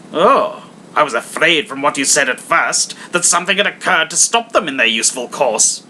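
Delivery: put-on rising, sarcastic old miser; mildly sneering Scrooge; mocking Scrooge. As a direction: needle them with the question.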